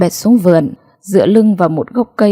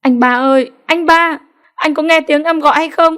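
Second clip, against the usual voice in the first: high-pitched cry calling across the river; screaming out; 8 semitones higher than usual. A narrator's reading. Wife screaming for her husband lost in the river, high and desperate. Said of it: "Chị gào lên".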